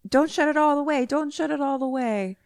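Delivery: put-on high-pitched child's bedtime plea; pleading kid voice. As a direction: imitate a little boy begging, said twice.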